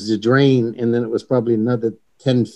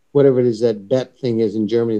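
ordinary delivery

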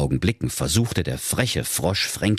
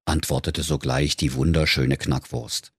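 usual speaking voice

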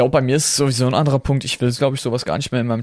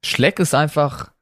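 ordinary delivery

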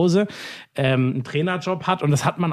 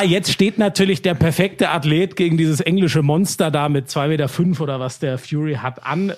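ordinary delivery

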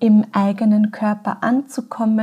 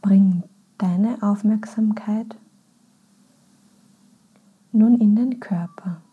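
guided meditation. Acted. Podcast host leads listeners into a body scan, calm and unhurried. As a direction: guide them gently inward, phrase by phrase.

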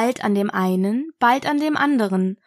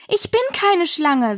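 higher, indignant denial; 7 semitones higher than usual. A narrator's reading. Alice denies being a snake, indignant. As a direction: protest as an affronted Alice. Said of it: "sagte Alice mit Entrüstung".

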